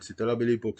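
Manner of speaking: usual speaking voice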